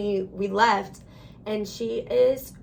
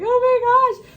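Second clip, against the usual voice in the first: much higher and louder distressed cry; retelling her panic as her sister cried in pain; near-tears outburst; 13 semitones higher, 9 dB louder than usual. Retold or imitated. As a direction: burst out in alarm, close to tears. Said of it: "And I wanted to cry".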